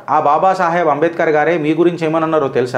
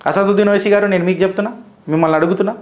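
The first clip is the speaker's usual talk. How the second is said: normal talking voice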